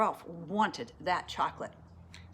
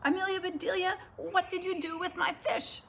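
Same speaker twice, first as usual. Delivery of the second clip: angry shout; 9 semitones higher than usual. A narrator's reading. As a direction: demand an answer from the maid, outraged. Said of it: "he shouted".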